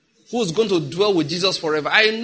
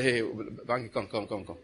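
quiet, low coaxing of himself; talking softly to himself in the mirror; soft coaxing to self; 7 semitones lower, 8 dB quieter than usual. Shown as a demonstration. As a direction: coax your own reflection gently. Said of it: "look at the mirror and say".

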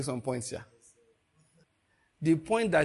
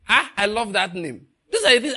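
delighted laugh, louder and higher; preacher thrilled by the verse; 5 semitones higher, 6 dB louder than usual. Real, not acted. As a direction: beam through the words with a laugh.

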